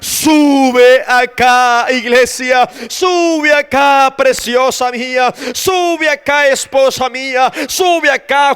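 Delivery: calling voice of the Lord. Acted. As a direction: call the faithful up one by one.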